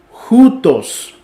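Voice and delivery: jutos, throaty J like Spanish jota; rough breathing J sound. Shown as a demonstration. Teacher to students, jutos with a J sound. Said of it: "sino que sería joutos o jutos".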